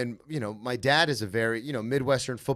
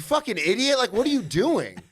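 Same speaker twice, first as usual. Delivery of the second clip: higher-pitched mock scolding; mock angry yelling; 9 semitones higher than usual. Imitating the yelling he grew up around, mock angry and high. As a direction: imitate a yeller tearing into someone. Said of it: "I grew up in that environment".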